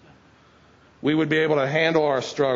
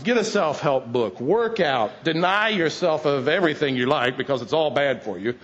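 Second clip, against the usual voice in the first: ordinary talk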